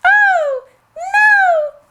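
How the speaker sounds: very high, pleading little goat voice; crying littlest goat. Acted. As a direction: plead in mock terror as a tiny goat. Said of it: "cried the littlest billy goat".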